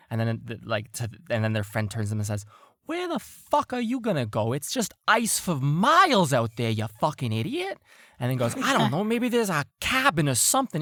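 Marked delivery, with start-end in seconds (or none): 2.8-7.8 high-pitched hammed-up outrage; exasperated arguing. Crewman scolds his friend's plan, exasperated. Acted quarrel between two crewmen. 8.6-10.8 high, fast squabbling retort; defensive arguing. Acted. Crewman defends his idea to friend, defensive. Acted quarrel, friend answers back.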